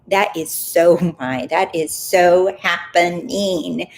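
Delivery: "so" drawn out slowly with a laugh; natural, emphatic claiming. Shown as a demonstration. Coach showing how she naturally talks, drawn-out emphatic "so". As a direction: stretch "so" and "happening" with relish. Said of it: "for me to talk like that".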